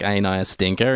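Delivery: quoted flat in his own voice; just say it evenly, no impression; plain Gary Oldman line. Podcaster quoting the line to his cohost, plainly. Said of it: "he's like".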